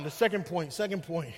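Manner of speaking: usual speaking voice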